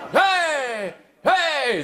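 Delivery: high, hammed-up partygoer yelps of hey; mock party shouts. Mimicking the juniors partying behind him, high mock hey shouts. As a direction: imitate rowdy young comedians whooping. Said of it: "芸人らしからぬおもろい声".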